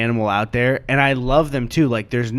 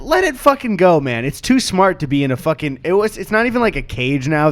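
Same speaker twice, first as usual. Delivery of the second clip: usual speaking voice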